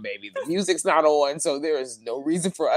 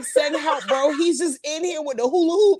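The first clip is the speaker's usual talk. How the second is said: much higher, a pretend call for rescue; mock alarm; 12 semitones higher, 4 dB louder than usual. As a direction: plead for help in comic disbelief. Reacting in horror to film.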